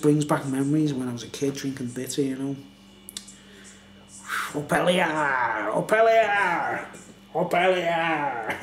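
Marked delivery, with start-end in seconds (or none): 4.5-8.6 slow, drawn-out battle chant; rallying Viking chant, slow and proud; Viking declaration. Pretend. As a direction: call it out like a Viking war cry. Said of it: "That's a Viking declaration".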